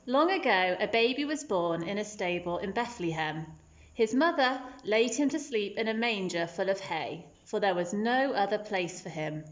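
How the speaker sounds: ordinary delivery, nothing marked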